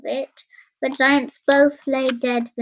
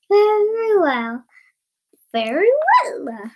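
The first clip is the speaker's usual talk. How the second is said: high, chirpy opening that drops away; chirping; 7 semitones higher than usual. Acted. Tailor accepts the king's offer, chirping. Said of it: "chirped the tailor".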